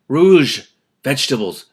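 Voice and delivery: usual speaking voice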